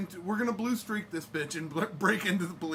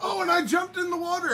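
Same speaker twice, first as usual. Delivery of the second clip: excited; 10 semitones higher, 6 dB louder than usual. Real, not acted. Excited recall of jumping in.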